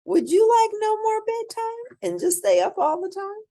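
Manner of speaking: excited question. Acted. Storyteller teases the children with a high excited question. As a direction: lift the voice high to tempt the kids. Asking kids about no bedtime.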